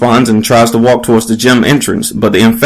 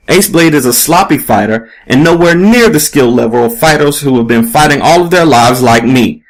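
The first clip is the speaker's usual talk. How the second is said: ordinary delivery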